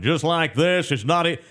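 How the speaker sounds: a hint of a booming announcer; Dave South impression. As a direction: pitch it up like a vintage radio caller. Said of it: "this is how he sounded".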